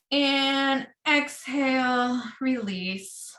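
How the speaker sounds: long drawn-out exhale cue; guided exhale. Acted. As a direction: stretch out the release. Cue to release breath.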